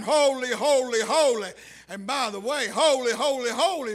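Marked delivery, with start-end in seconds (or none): 0.0-1.5 loud, ringing angelic acclamation; crying out. Retold or imitated. Preacher voices cherubim flying and crying, crying out. 2.7-3.9 loud pulpit cry of praise. Shown as a demonstration. Preacher shows what can be cried, crying out. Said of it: "is one thing you can cry".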